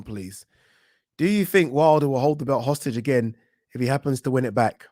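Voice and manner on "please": ordinary talk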